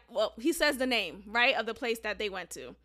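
normal talking voice

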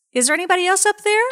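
high, loud, fast shout; the dangling man yells skyward for another rescuer; panicked yell. Retold or imitated. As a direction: yell it out in comic desperation. Joke punchline yelled.

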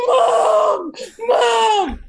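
high wailing call; crying for mom. Retold or imitated. Sobbing teen calling her mom from the step, high wail. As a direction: wail it like a scared kid.

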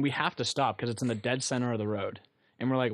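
normal talking voice

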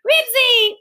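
high, loud wail of a dog's name; wailing call. A narrator's reading. Henry pleads for Ribsy to come, wailing. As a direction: wail out for the dog. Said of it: "wailed Henry".

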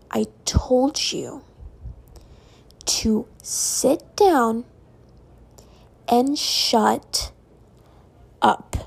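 slow, drawn-out order with long pauses; retold snapping. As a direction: space out every phrase like a fed-up teacher. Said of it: "She had never snapped at me like that".

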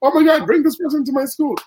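high, quick excited fan imitation; retold eagerness. Someone sees the viral video and wants a booking, retold eagerness. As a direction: gush it fast like a thrilled viewer.

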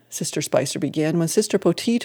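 ordinary delivery

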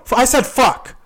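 high, loud insistence; defiant retort. Retold or imitated. Repeating the swear word in argument.

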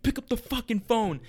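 frantic caller begging the store to pick up. Retold or imitated. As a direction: plead impatiently for someone to answer. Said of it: "I just frantically called".

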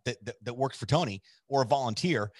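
usual speaking voice

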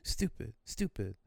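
put-on high, slow toddler chant; little kid name-calling. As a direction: repeat the insult like a small child. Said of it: "I just started calling him stupid. I was a kid".

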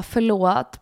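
ordinary talk, nothing marked

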